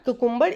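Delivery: "cucumber" as koo-KOOM-ber; wrong pronunciation. Shown as a demonstration. Teacher naming the mistake to learners, wrong "cucumber" as koo-KOOM-ber. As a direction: say "cucumber" the mistaken way, with two oo vowels. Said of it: "is wrong pronunciation".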